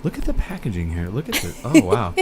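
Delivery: hushed, breathy mock influencer; soft ASMR influencer showing off cans; quiet mock unboxing influencer voice. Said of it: "you don't do that thing where you're all".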